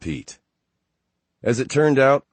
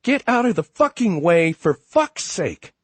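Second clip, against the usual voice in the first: shrill, much higher scream; 12 semitones higher, 6 dB louder than usual. Acted. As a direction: scream it out the window. Woman leans out, yells at traffic.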